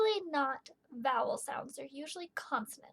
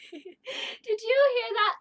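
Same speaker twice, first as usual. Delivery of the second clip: laughing delight; 7 semitones higher, 6 dB louder, much slower than usual. Real, not acted. Delighted laugh over favorite line.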